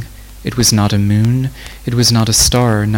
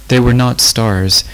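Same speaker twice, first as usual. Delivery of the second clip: plain reading voice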